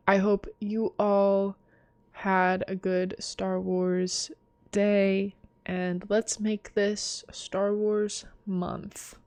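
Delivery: slow, soft, drawn-out sign-off; tired talk. Real, not acted. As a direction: wish them well slowly and wearily. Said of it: "I don't know why I'm talking like this".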